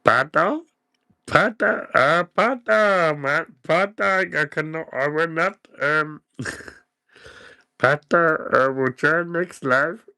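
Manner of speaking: high put-on voice of Pat; a high, half-working impression of Pat; Pat voice attempt. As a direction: try to sound like Pat, high up. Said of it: "I can't do the Pat voice yet".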